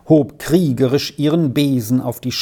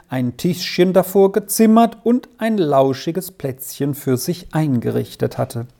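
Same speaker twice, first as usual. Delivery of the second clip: ordinary delivery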